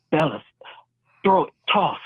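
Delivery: high-pitched quoted call to the crew; imitated man calling out. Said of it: "then you hear him say".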